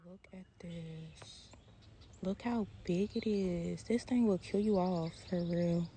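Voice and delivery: hushed slow whisper; breathy awed whisper. Real, not acted. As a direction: keep it hushed so it won't hear. Whispering near animal.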